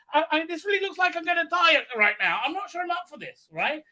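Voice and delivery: high-pitched, hesitant mock worry; mock nervous dread. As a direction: stammer in mildly hammed-up fear. Acts character dreading death.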